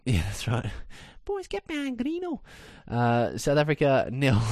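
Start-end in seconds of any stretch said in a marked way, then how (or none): 1.3-2.4 high Scouse footballer voice, pitched up; Scouse accent. Retold or imitated. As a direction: Liverpool accent, Gerrard-style rallying call. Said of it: "Do a great Scouse accent".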